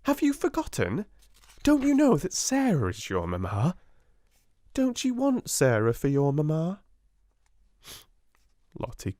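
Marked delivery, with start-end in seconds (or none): 0.0-6.8 higher playful reassurance; cheerful coaxing. A narrator's reading. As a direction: coax her out of crying. Sarah cheering up the child.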